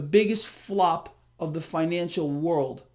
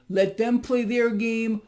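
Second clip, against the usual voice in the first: ordinary delivery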